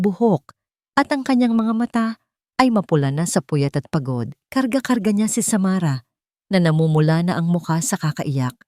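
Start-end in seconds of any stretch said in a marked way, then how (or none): none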